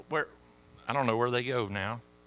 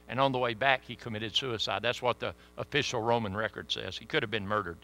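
usual speaking voice

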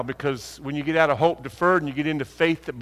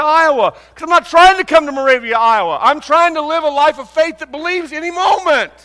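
high, loud, rising preacher's cry; full-force preaching; 12 semitones higher, 8 dB louder than usual. Acted. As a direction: preach it out with everything you've got.

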